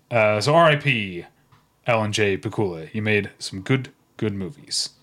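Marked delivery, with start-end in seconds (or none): none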